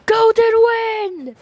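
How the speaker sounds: high, loud battle cry, drawn out slow; yelled stand call. Acted. Giorno summons his stand for the fight, yelling high and loud. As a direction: shout the stand's name to summon it. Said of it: "he yells out".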